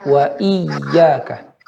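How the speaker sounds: "iyyaka" with a heavy, held double y; iyyaka stressed slowly. Shown as a demonstration. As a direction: press hard on the doubled y of "iyyaka". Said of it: "ditekankan lagi, digenjot".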